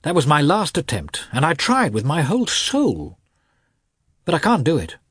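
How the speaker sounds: high, pleading confession; guilty. A narrator's reading. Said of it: "said Lievin guiltily".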